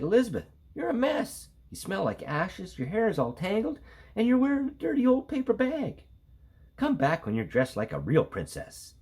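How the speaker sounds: high, prissy prince scolding; snooty prince. Acted. Prince sneering at the princess's clothes. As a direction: look down on her mess.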